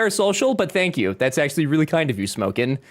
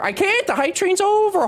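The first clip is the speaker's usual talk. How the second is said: a shrill-free, pitched-up whine of refusal; high squealing protest. Real, not acted. Streamer protesting to Harley that the dance is over, squealing high.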